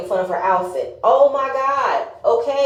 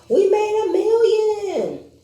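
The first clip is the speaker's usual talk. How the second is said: high, slow, bragging singsong; hammed-up bragging Toya; mock boast; 12 semitones higher, slower than usual. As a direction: draw it out like a show-off. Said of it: "Toya commented on".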